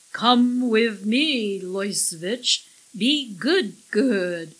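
loud, slow, booming creature; train-like alien voice. A narrator's reading. Alien orders the captives along, train-like booming. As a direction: boom it out slowly like a talking train. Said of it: "It sounded like a train speaking".

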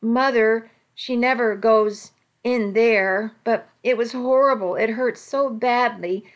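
ordinary delivery

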